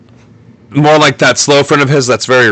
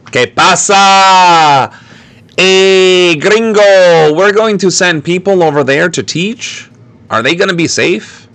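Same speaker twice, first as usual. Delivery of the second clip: higher, hammed-up Mexican accent; cartoonish, higher-pitched Mexican accent; bad Mexican accent; 5 semitones higher than usual. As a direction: ask in a corny put-on Mexican accent. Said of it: "I shouldn't have done that accent".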